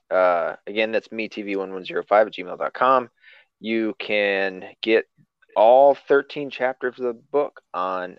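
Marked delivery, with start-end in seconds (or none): 3.6-8.2 slow slurred words; stumbling slow read. Real, not acted. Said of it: "I said it like a drunk person".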